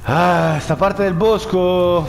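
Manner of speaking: startled. Real, not acted. Player entering dark woods, startled. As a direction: gasp it out, spooked. New part of forest, startled.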